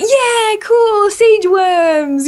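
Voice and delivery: nostalgic excitement. Retold or imitated. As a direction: gush over old memories, slow and high. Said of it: "you're like".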